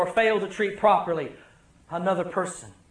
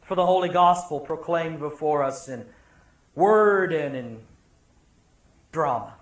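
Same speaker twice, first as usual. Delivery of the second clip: prayer; 5 semitones lower, 3 dB quieter than usual. Real, not acted. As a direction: pray it softly, reverently. Opening prayer.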